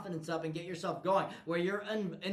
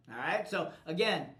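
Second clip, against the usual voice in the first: usual speaking voice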